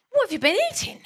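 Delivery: loud, high incredulous question; hammed-up astonished outcry; appalled disbelief. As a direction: blurt it out, mock-aghast. Dan's acted startled question.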